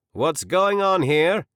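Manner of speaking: loud, slow commanding soldier; strong male voice. A narrator's reading. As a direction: halt the crowd with slow authority. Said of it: "came a strong male voice".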